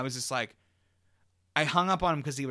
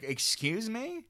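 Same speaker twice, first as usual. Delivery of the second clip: drawn-out, rising, higher-pitched disbelief; incredulous; 6 semitones higher, 5 dB quieter, much slower than usual. Retold or imitated. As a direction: stretch it into an indignant question. Incredulous reply to being fired.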